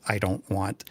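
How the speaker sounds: normal talking voice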